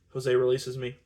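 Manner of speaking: ordinary delivery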